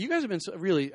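normal talking voice

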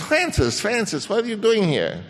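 put-on Viennese accent. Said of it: "he sort of had a Viennese accent".

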